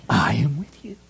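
breathy whisper of the answer; whispered hint. Shown as a demonstration. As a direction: whisper it like a secret. Preacher hints the answer.

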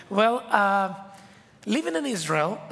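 ordinary delivery

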